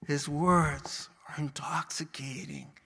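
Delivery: awed whisper. Acted. Preacher to the congregation on Jesus' words, whispered. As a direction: breathe it out in a whisper.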